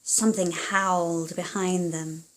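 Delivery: lively storybook reading aloud; reading to children. Acted. Parent reading a picture book to a child, animated storytelling. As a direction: read it like a bedtime story to little ones.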